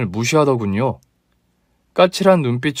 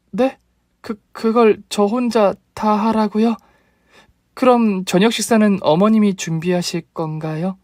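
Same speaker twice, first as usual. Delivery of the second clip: higher, stammering protest; lightly voiced startled bride; a flustered daughter-in-law's protest; flustered young wife; 8 semitones higher than usual. A narrator's reading.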